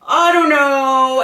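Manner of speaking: hammed-up noncommittal husband; evasive husband. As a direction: dodge it like a husband avoiding sides. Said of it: "he's like".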